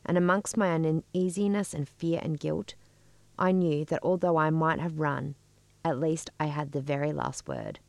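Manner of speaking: measured, even read-aloud narration; story reading. A narrator's reading. Writer reading their childhood story to listeners, composed story reading.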